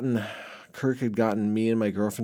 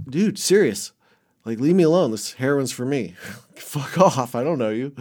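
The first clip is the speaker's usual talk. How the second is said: higher and louder, telling him off; retold annoyed yell; 3 semitones higher, 4 dB louder than usual. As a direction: get rid of the stranger, loud and fed up. Telling hobo to leave.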